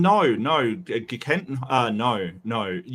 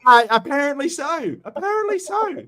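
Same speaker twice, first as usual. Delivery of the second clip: very high, squeaky-pitched protest; mock-exasperated concession; 5 dB louder than usual. Real, not acted. Teased about sounding British, high-pitched exasperated concession. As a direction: give in with your pitch shooting up.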